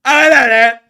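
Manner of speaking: shouted high Cockney caricature; hammed-up Cockney; Cockney accent. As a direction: bellow it like a pub regular. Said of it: "enjoying the Cockney accent".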